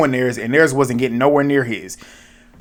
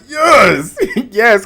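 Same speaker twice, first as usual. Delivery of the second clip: much higher, louder yes, laughing into the second; cheering the psycho's eviction with a laugh; gleeful yes; 9 semitones higher, 4 dB louder than usual. Retold or imitated. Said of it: "I was like".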